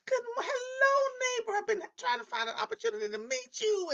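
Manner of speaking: high, bright-eyed neighbor greeting, mimicked; eager neighbor voice. Retelling how the new neighbor greeted her, high mimicked voice.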